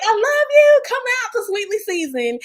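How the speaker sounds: high, loud fan cheer; imitating cheering. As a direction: scream it out like a superfan at the door. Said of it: "You tapped into your cheering bag".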